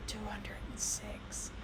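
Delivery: slow, soft whispered aside; mother whispering the answer. Retold or imitated. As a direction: whisper the answer into his ear. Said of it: "I lean over and I go".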